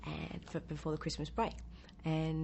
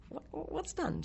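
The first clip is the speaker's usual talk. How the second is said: higher bewildered question; confused surprise; 11 semitones higher, slower than usual. Retold or imitated. Actress baffled by the makeup artist's news. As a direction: ask in baffled disbelief. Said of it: "And I said".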